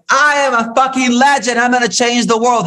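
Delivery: high, loud mock bravado; hammed-up I am a legend; grandiose boast. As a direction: crow it like a self-declared legend. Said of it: "come out like".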